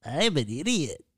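high, swooping put-on voice at normal volume; different silly voice. Asked for a different voice, husband does a swooping one. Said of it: "Can you say it in a different voice?".

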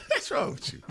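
breathy laughing question. Real, not acted. Laughing at cohost.

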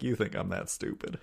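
lightly voiced folksy hero; John McClane. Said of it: "Your John McClane sounds like George W. Bush".

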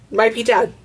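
normal talking voice

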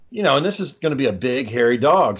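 ordinary talk, nothing marked

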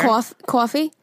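imitating a New York "coffee"; her New York accent. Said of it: "say something in her accent".

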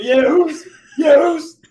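high, very loud wrestler taunt; mock taunting yell. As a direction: jeer it at full volume, half laughing. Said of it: "He's like".

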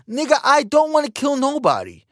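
high, exasperated mock reply. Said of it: "He's like".

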